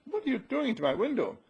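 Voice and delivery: lightly acted mother, pitched high; mother questioning her boy. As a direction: ask it like a startled mom. Voicing mother at muddy window.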